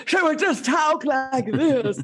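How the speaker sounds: a light, slow imitation of the Jodie Foster accent; imitating Jodie Foster.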